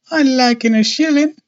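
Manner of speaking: put-on high-pitched little boy voice; small boy. A narrator's reading. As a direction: pipe up high like a hopeful child. Narrator voices small boy Jack.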